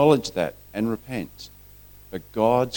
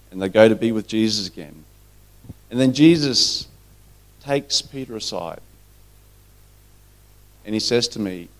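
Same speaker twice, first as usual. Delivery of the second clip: normal talking voice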